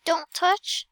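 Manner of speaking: normal talking voice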